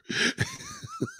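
soft squeaky giggle; high quiet giggle; high laugh. Real, not acted. Laughing at the name.

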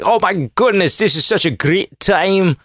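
high-pitched attempt at Russian sliding from German to Scottish; botched Russian accent. Gleeful Putin and friends having a great time, botched Russian accent. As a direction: put on a badly wandering Russian accent. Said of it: "That's not a Russian accent at all".

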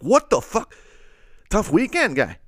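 half-joking gasp at the bruises; shocked. Retold or imitated. Seeing the beaten-up guy.

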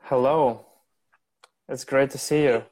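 usual speaking voice

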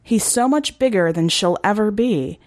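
ordinary delivery, nothing marked

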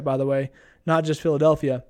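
normal talking voice

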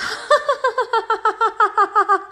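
high, rapid mock cackle; imitated cackling. Girls laughing at her insult, high imitated cackling. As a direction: cackle like a whole group of girls. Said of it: "cackling, cackling, cackling".

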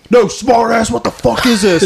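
a hint of a sneering corporal; mock angry corporal. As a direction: lightly play the sneering corporal catching you out. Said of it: "He's like".

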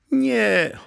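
high, loud little ghost voice. A narrator's reading. Stone's ghost begging not to be thrown out, high little voice. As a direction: cry out in a small high voice. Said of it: "odezwał się głosik".

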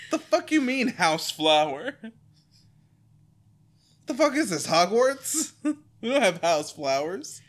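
high, loud disbelief; can't believe this line; outraged squeal; incredulous. Real, not acted. Incredulous rant at dialogue.

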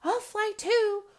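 high, loud whooping owlet; excited calling out. Acted. Small owlet wants to fly too, calling excitedly. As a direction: whoop it out at the top of the voice. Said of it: "Four called".

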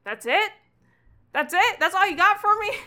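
put-on a kid's voice cracking up into disbelief; outraged kid. [name] protests Ewan's meagre answer, shrill indignant yell.

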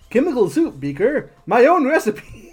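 a hint of a giggly Muppet scientist; Bunsen Honeydew voice. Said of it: "do the Bunsen honeydew voice from here on out".

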